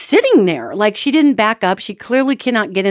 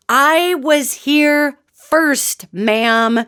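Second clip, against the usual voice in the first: high, drawn-out mock yell; retold yell; 5 semitones higher, much slower than usual. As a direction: imitate screaming at a driver, stretching each word. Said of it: "I yell at the top of my lungs".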